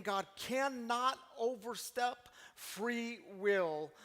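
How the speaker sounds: cannot stretched very slowly; stress on cannot. Shown as a demonstration. Pastor answering the objection, slow firm stress. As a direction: draw out cannot with weight. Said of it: "that word cannot".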